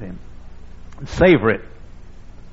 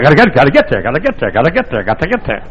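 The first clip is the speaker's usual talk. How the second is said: mocking hurry; 3 dB louder, faster than usual. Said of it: "too busy trying to get there".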